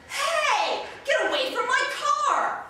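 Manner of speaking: high, loud shout across a parking lot; loud high warning shout; alarmed projected yell. Shown as a demonstration. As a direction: yell to scare off a car thief. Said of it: "your immediate reaction is to say".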